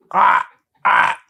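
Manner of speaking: put-on breathless voiceless ah ah of a drunk; drunken groan. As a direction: groan it out half-conscious, airless. Drunk groan against the door.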